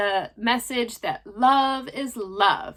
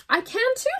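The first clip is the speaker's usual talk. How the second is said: high worm voice; 13 semitones higher than usual. Acted. Second worm chimes in.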